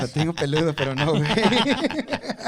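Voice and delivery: laughing loud and high. Real, not acted. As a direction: blurt it through laughter. Joking after girl parts tease.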